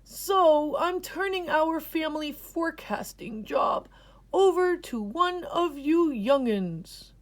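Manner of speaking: grandpa's wise explanation; old grandpa explaining. A narrator's reading. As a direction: lay out the rules like a wise elder. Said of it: "said Grampy".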